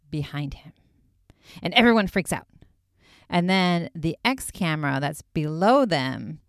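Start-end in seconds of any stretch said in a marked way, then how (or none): 0.1-0.7 spooky whisper. A narrator's reading. Vlogger building suspense for viewers, whispered. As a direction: drop to a hushed whisper.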